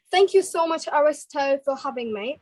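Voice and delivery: British-accented thanks; British English, addressed to hosts. Shown as a demonstration. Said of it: "starting with the British accent first".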